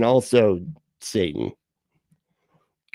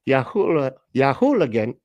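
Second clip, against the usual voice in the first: higher-pitched bad Irish accent; terrible Irish accent; 4 semitones higher than usual. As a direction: put on a hammed-up Irish accent. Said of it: "that was a terrible accent".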